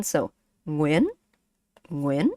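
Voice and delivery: Nguyên said with a rising question-like tone, ng at the start; teacher models Nguyên slowly, rising at the end like a question; Vietnamese pronunciation. Shown as a demonstration. Teacher shows a student how to say Nguyên, rising. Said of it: "That's how you say it".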